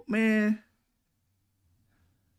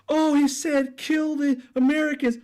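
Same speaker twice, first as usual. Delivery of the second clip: mocking America; 11 semitones higher than usual. Mocking Americans' outrage at Iran, shrill mock whine. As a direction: sneer it in a high nagging voice. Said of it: "America's gonna be like".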